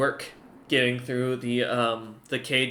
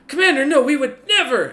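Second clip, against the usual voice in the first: much higher, loud pleading; guard begging his commander; panicked denial; 13 semitones higher, 9 dB louder than usual. Acted. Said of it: "you hear one of them go".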